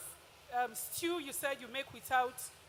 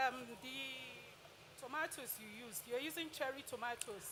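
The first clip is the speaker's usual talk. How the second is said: hard to hear; 9 dB quieter than usual. Real, not acted. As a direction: ask too softly for the room to hear. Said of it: "I really can't hear you".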